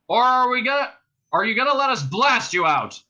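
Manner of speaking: loud, high cop ultimatum; shouted threat. A narrator's reading. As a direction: shout the choice at them. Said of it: "shouted one of the cops".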